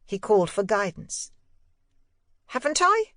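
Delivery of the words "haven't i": put-on high, light boyish voice, innocent; a small boy feigning surprise; young boy Miles. A narrator's reading. Boy answering his governess, high and innocent.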